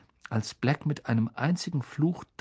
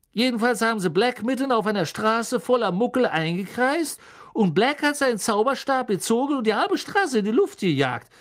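high, loud, breathless storyteller; Stan dramatic gossip; 7 semitones higher, 5 dB louder than usual. Acted. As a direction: pile on the horror with glee.

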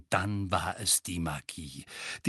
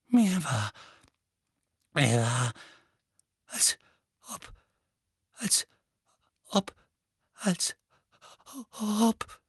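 stammering; 8 semitones higher, slower than usual. A narrator's reading. Dudley stammering, shaken.

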